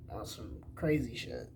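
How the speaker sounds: normal talking voice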